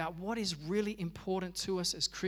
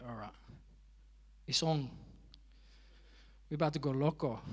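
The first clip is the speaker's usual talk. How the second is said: mock Mexican accent; 3 semitones lower, 5 dB quieter, faster than usual. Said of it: "I don't know why I turned Mexican".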